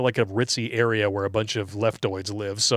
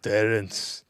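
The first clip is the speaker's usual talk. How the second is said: Russian doctor; 4 semitones lower, 7 dB quieter than usual. Retold or imitated. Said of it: "my Russian doctor".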